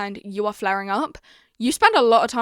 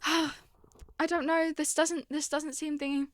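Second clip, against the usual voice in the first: high, soft wavering doubt; imitating doubtful listener; 5 semitones higher, 9 dB quieter than usual. Vlogger mimicking a viewer unsure about her techniques, doubtful. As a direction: hem and haw in a small high voice. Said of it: "and you're like".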